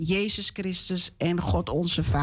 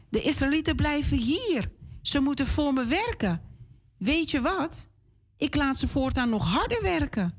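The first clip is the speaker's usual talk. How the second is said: high, scornful king's threat; mock a cruel king's boast; angry pharaoh; 7 semitones higher than usual. Pharaoh threatens Moses, high and scornful.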